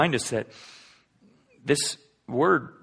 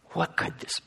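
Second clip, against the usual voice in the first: whisper; 3 semitones higher, 9 dB quieter than usual. Retold or imitated. Imagining disciples' fearful wonder.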